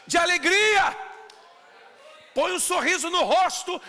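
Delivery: high, loud rising preacher's cry; loud joyful preaching. Real, not acted. Pastor describing the Holy Spirit, loud joyful cry. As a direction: lift it like a shout of joy.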